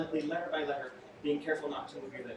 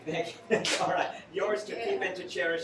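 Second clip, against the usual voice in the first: usual speaking voice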